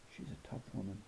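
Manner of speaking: ordinary delivery, nothing marked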